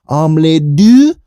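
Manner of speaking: slow, drawn-out French cartoon voice; mock French accent. Imitating Dexter's stuck phrase, slow mock French accent. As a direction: savour every syllable in hammed-up French. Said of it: "roll it off his tongue like a French guy".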